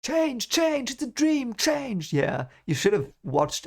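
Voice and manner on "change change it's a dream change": much higher, otherworldly chant of change; high haunting voice insisting it's a dream; eerie dream voice. Acted. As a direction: call it out like a voice from a dream. Voicing the book's dream character.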